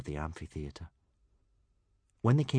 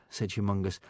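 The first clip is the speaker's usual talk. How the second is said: plain reading voice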